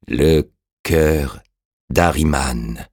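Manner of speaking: halting echo with a long pause; revived dead man. A narrator's reading. The revived man echoes Orastès, slow and halting. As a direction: repeat it as memory slowly returns.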